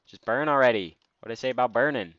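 loud annoyed shout at an enemy; frustrated yelling. Real, not acted. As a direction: yell in exasperation. Yelling at a skeleton.

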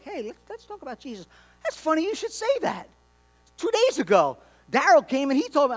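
high, hammed-up chatty coworker; mock coworker. Preacher acting out a coworker's story, hammed-up high voice.

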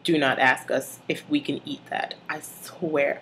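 normal talking voice

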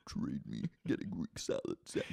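very low, hushed possessed voice; Katie Maloney. Retold or imitated. Possessed Katie floating in the kitchen, low eerie murmur. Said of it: "floating in the fucking kitchen being like".

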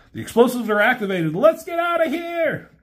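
high, fast urgent call to run; high cartoon hero in a rush; hurried escape line. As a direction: hurry everyone out before the blast. Spike fleeing the bomb.

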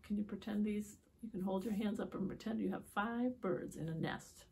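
plain reading voice

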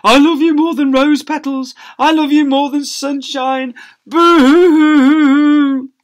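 high, loud hammed-up blubbering; blubbering love. Family weeping love at assembly, loud mock blubbering. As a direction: wail the love through sobs.

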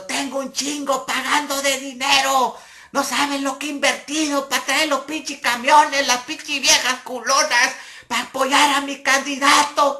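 high, loud, wailing complaint; near tears. Retold or imitated. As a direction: lament the money lost, nearly crying. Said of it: "Estaba casi llorando".

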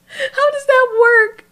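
high, slow, breaking into laughter; laughing disbelief. Real, not acted. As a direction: ask it while cracking up. Laughing at absurd comic art.

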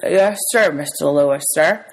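put-on muffled boy talking round food; mouth full. A narrator's reading. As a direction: answer politely through a bite of sandwich. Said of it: "shoved the part of the sandwich that I was chewing into the side of my mouth".

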